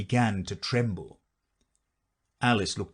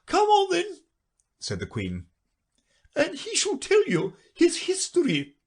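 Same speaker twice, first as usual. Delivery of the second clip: bossy queen voice. A narrator's reading.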